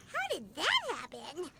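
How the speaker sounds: ordinary talk, nothing marked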